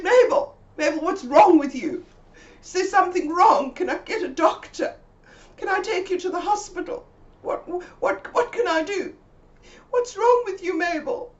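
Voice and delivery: high, loud rush of worried questions; frantic worried questions to a friend. Retold or imitated. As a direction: pepper her with panicked questions.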